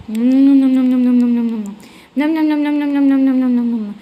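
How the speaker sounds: greedy loud chomping sounds; loud munching. Acted. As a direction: chomp noisily like a hungry hero. يأكل البيتزا بنهم. (صوت مضغ عالي)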